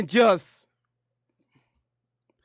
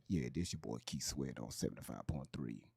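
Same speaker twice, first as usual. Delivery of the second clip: much lower, softer smooth radio host; late night radio DJ voice; 18 dB quieter than usual. Retold or imitated. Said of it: "them late night radios when they be talking like this".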